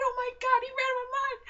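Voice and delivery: very high-pitched screamed disbelief; re-enacted thrilled shriek; excited yelling. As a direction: squeal it loud, as if watching live. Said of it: "me and my sister were yelling".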